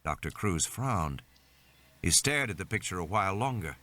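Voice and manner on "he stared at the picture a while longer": ordinary delivery, nothing marked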